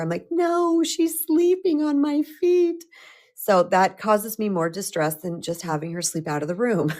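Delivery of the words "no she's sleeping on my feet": much higher, slow, pleading whine; whiny protest. Retold or imitated. Retelling to husband, a high slow whiny protest. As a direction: drag it out like a stubborn kid refusing. Refusing husband's suggestion, retold.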